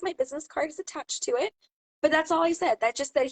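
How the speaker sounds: normal talking voice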